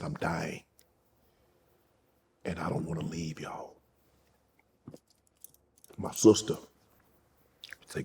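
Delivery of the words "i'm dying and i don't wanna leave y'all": put-on much softer, fading near-whisper of a frail old woman; dying grandmother. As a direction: let it barely come out, weak and low. Dying grandmother voiced in story.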